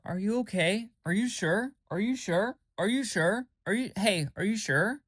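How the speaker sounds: high questions, each lifting up at the end; mock concerned nagging. Mimicking a friend who keeps checking, mock concern. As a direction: imitate a friend insistently checking in.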